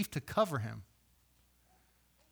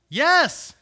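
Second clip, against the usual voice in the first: loud, higher snapped yes; sharp retort; 8 semitones higher, 7 dB louder than usual. A narrator's reading. Jonah answering God, a loud, higher retort. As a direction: Jonah snaps back his answer defiantly. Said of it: "Jonah retorted".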